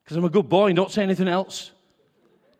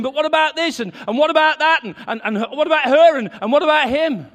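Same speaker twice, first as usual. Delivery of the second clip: high, fast, flustered questions; agitated fretting; 6 semitones higher, 4 dB louder, much faster than usual. Retold or imitated. Husband fretting to his wife, high and rushed. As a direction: pile up the worries in a rush. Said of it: "and I'm saying".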